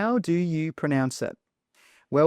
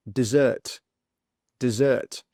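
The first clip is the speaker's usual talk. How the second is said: "dessert" stressed on ZERT, weak start; di-ZERT, lean on the end of "dessert"; second-syllable stress. Shown as a demonstration. Teacher pronounces the next word, stress on ZERT. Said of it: "stress is on the second sound".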